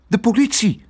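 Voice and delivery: put-on high, urgent child's exclamation; high-pitched, anxious announcement; alarmed girl. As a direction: blurt out the danger in alarm. Girl reveals why they flee.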